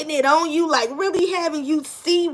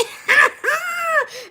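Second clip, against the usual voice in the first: loud high laughing burst; shrieking laugh; 14 semitones higher, 5 dB louder than usual. Real, not acted. Laughing loudly at her own joke to viewers.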